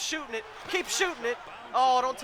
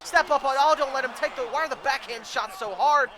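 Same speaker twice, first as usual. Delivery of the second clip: normal talking voice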